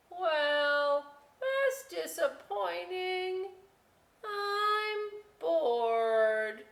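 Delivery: potato voice. Acted. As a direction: drag it out, let down.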